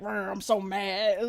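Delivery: hammed-up little "Rrr" of rage; mock angry growl. Mimicking the guy pacing his living room, mock fury. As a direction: make fun of his fuming with a pretend growl. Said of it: "angry as fuck right now".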